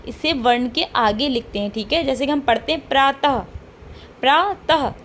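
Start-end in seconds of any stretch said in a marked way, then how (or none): 2.9-3.4 प्रातः ending in an h sound; visarga demonstration. Shown as a demonstration. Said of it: "तः कहते हैं तो हा की ध्वनि". 4.2-4.9 प्रातः split as "praa, tah", pitched higher; teacher's higher, separated प्रा-तः with an h ending; visarga demonstration. Shown as a demonstration. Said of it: "तः कहते हैं तो हा की ध्वनि".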